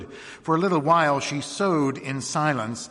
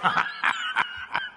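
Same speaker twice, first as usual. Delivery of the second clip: scornful laugh. Acted. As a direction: laugh off the women's question. Sheriff laughs at the women.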